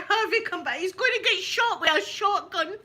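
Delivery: high, loud panicky call; calling the dog. Retold or imitated. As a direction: yell after the dog again. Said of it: "and I'm shouting".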